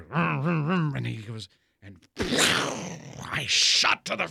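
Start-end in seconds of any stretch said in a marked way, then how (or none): 0.0-0.9 mouth-made snowmobile vroom; playful vroom vroom engine imitation; engine noises. 2.2-3.3 a real laugh mid-story. Real, not acted. Laughing at his own story.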